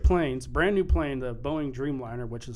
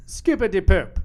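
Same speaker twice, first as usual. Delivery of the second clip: mock lyric; 7 semitones higher, 11 dB louder than usual. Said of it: "when you go".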